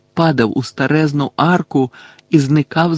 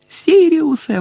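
very high-pitched desperate call; high cry, falling at the end; yelling; 13 semitones higher, 4 dB louder, slower than usual. A narrator's reading. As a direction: wail the name, calling someone back. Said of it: "волав він".

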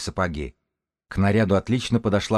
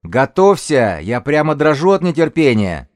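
high, loud, cocky jeer; taunting shout; 7 semitones higher, 7 dB louder than usual. Acted. Young lookout goads the woman leader, high loud taunt. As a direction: crow it across the distance.

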